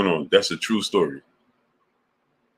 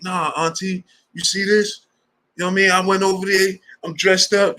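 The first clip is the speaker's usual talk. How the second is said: imitating him, high-pitched earnest pleading; hammed-up high voice, insisting; serious nephew; 11 semitones higher than usual. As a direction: mimic a dude earnestly explaining himself to auntie. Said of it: "He was serious".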